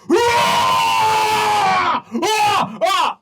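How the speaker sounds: high, loud terrified screams. Acted. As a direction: scream as the ogres break through. Guards scream as ogres attack.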